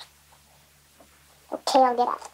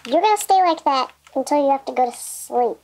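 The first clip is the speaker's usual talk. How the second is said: ordinary talk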